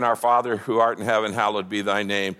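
low, even recitation by rote; memorized prayer. Acted. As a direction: intone a familiar prayer mechanically. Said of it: "he's not praying some memory".

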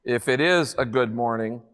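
low, weary gloomy donkey voice; gloomy Eeyore. A narrator's reading. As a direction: sigh it out like a gloomy old donkey. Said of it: "said Eeyore gloomily".

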